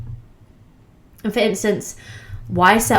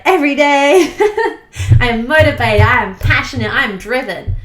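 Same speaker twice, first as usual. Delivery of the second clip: higher, giggling into the affirmations; laughing excitement; 4 semitones higher than usual. Shown as a demonstration. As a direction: gush through a laugh. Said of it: "Whereas you say, like".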